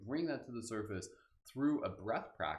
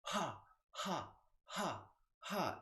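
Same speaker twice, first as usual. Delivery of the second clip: rising, pulsed ha breaths pushed from the belly; breathy ha exhales; 7 semitones higher, much slower than usual. Shown as a demonstration. Teacher showing newcomers the exhale, breathy rising ha breaths. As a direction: demonstrate the laughing exhale. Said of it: "is just going to sound like this".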